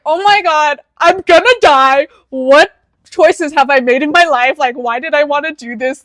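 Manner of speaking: dramatic panic. Retold or imitated. Retold panic during bad turbulence.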